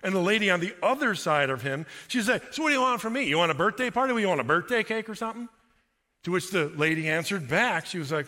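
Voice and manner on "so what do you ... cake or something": sarcastic retort. Retold or imitated. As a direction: snap back with scorn, pitched up. Sarcastic woman in retold diner scene.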